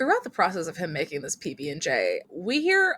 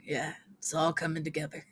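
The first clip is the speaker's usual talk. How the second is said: Kronk impression; 3 semitones lower, 8 dB quieter than usual. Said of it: "I had Kronk in the back of my head going".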